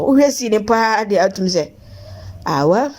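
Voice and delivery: ordinary delivery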